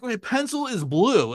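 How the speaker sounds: slow earnest tourist sentence; earnest phrasebook line. Retold or imitated. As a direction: say it with wide-eyed sincerity, slowly. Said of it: "just do it so earnestly at a bar".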